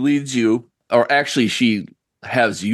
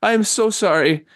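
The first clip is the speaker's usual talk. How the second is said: put-on old woman voice; 9 semitones higher, faster than usual. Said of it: "She's like".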